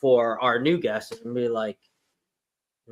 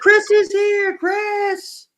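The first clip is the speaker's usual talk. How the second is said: very high, loud excited call; 6 dB louder, much slower than usual. Real, not acted. Host calling out that Chris is here, excited. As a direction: announce the arrival in a high holler.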